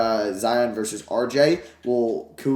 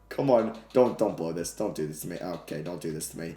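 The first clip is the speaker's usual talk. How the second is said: soft, lower, begging under the breath; nervous pleading; 8 semitones lower, 6 dB quieter than usual. Real, not acted. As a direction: plead with the game not to fail you. About to blow a lead.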